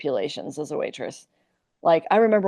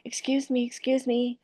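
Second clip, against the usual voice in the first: higher-pitched, polite Iowa voice; put-on Iowa accent; 6 semitones higher, 4 dB quieter than usual. Waitress calling after bad tippers, sweet Iowa accent. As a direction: turn on the Iowa to catch their attention. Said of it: "I'd turn on my Iowa".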